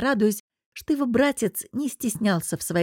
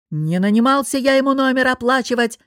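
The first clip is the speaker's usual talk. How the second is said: loud, higher furious yell; boss yelling; 5 semitones higher, 6 dB louder than usual. Acted. Boss screams refusal to pay down the phone. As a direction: scream it at the hotel manager. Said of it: "заорал босс".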